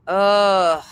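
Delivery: long, loud groan of exasperation; exasperated groan. Real, not acted. Listener fed up with the prank call, exasperated groan.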